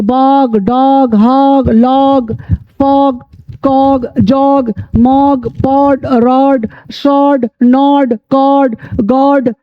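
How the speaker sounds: word list from "बाग" to "लॉप", each vowel a drawn-out aa; slow drill, "बाग", "डॉग", "हॉग" with long open aa; open AA pronunciation. Shown as a demonstration. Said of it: "आ होगी".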